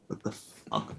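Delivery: breathed disbelief under the breath; learning the cousin's real name, a stunned whisper. Retold or imitated. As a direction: whisper it, floored by the news.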